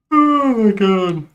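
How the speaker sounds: a long exasperated sigh of a phrase; exasperated groan. Real, not acted. Exasperated at the team's failure.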